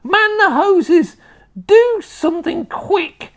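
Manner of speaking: slow, high, loud crone cries; frantic yelling. A narrator's reading. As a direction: howl orders in a panic. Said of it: "the old woman yelled".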